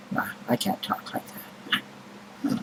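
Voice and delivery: breathy whisper, slow; whispered aside. Real, not acted. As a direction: drop to a whisper and drag it out. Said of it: "learn to talk posh".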